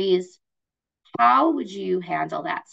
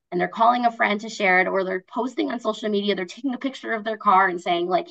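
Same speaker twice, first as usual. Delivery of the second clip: ordinary talk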